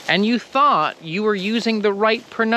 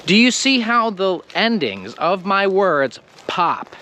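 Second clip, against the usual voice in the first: usual speaking voice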